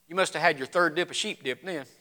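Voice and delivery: low, soft deadpan jibe; dry skeptical shopkeeper. Acted. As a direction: brush off the tall tale quietly. Shopkeeper mocks shepherd's story.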